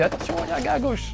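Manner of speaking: quick high warning call; teammate voice. Retold or imitated. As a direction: call out the danger fast and high. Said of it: "me souffle-t-on".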